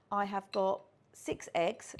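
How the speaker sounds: usual speaking voice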